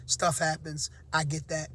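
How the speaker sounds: ordinary talk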